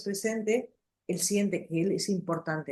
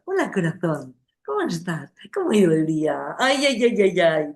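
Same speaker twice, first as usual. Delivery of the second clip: put-on silly baby voice; 8 dB louder than usual. Shown as a demonstration. Mother cooing at her baby, silly voice. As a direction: go all dopey and sing-song for the baby. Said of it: "con esta voz a-, de, como atontada".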